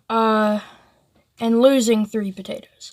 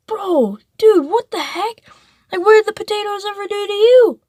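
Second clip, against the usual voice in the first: a pitched-up disbelieving rant; incredulous outburst; 10 semitones higher than usual. Retold or imitated. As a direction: yell at him like it's absurd. Friend stomping potato, incredulous outburst.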